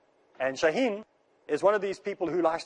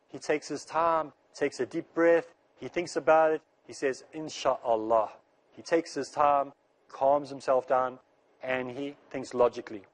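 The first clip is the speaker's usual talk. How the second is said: slow, lowered, deliberately calm pace; calm and unhurried; slower than usual. Acted. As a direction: take your time over every phrase like a man calming himself.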